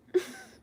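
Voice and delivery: sharp high burst of laughter; high loud giggle. Real, not acted. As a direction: squeal out a laugh. Laughs at a live comment.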